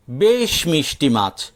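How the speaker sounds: ordinary delivery, nothing marked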